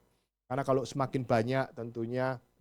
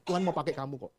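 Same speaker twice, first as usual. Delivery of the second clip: ordinary talk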